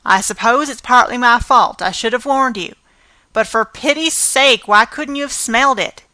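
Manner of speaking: exasperated scolding. Acted. Marilla exasperated.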